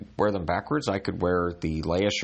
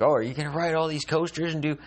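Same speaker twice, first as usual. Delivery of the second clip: higher, mock excited questioner; mock eager people; 9 semitones higher than usual. People asking him about coasters, mock eager. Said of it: "when people are like".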